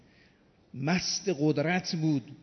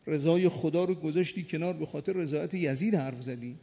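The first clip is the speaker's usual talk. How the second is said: normal talking voice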